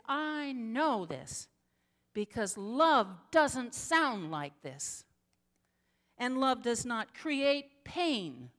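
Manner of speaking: slow, loud, each phrase hammered; slow emphatic preaching. Retold or imitated. Pastor hammers home what love sounds like, slow emphatic. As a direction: preach it at her word by word. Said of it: "lost my cool and I stopped being pastoral".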